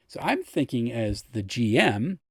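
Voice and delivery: normal talking voice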